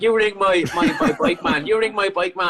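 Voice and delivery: high-pitched mock Thai accent; insistent, repetitive put-on Thai accent. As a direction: imitate Thai-accented English, pitched well up. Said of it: "I'm doing the accent".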